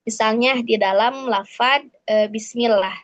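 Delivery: ordinary delivery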